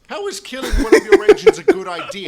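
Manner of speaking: loud high outraged cry; hammed-up horrified protest. Edward protesting to the Fat Controller, loud outraged cry. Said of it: "Edward began".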